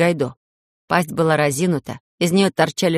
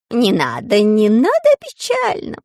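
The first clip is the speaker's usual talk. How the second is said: plea leaping to a very high, drawn-out cry; protest, then soar up high; high wailing exclamation; exclaiming; 4 semitones higher, 4 dB louder, slower than usual. A narrator's reading. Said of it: "воскликнула Алина".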